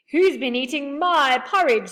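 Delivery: loud angry bear demanding answers; angry father bear. A narrator's reading. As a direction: bellow it like a cross papa bear. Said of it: "said the father bear angrily".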